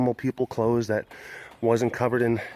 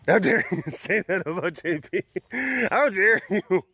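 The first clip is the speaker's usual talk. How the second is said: mock outrage; 7 semitones higher than usual. Mock outrage over ugly cat.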